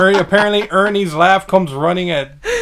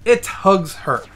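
"it hugs her" slow and higher; deliberate correction; 5 semitones higher, slower than usual. Real, not acted. Player fixing the pronoun, slow emphatic "it hugs her". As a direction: spell out "it hugs her" word by word, drawn out.

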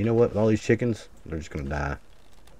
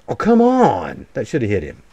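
frustrated; 7 dB louder than usual. Real, not acted. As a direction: yell at the miss. Missed shot again.

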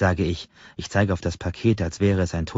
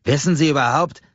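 loud indignant demand; 7 semitones higher, 5 dB louder than usual. Acted. Customer blustering at clerk.

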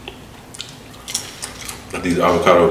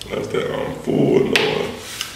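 whispered; 8 dB quieter, much slower than usual. Real, not acted.

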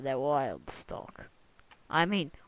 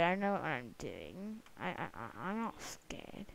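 ordinary delivery

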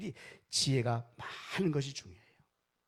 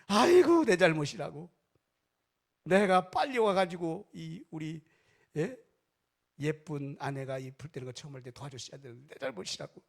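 loud lament; 2 semitones higher, 8 dB louder than usual. Retold or imitated. Husband returning home, wailing that it is his fault. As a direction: burst out crying the blame.

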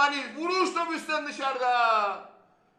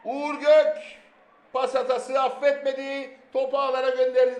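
screaming football commentator; 3 semitones higher, 8 dB louder than usual. Real, not acted. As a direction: roar the goal and keep the excitement. Goal call.

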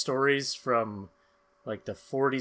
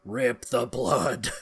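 ominous name reveal; 4 semitones lower, much slower than usual. Acted.

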